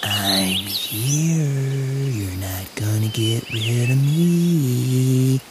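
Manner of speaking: slow, drawn-out spooky voice of anxiety; sinister sing-song taunt, stretched slow; whispering anxiety. Acted. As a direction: draw the words out like a creepy voice in the ear. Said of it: "Anxiety will whisper in your ear".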